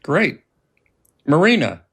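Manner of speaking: usual speaking voice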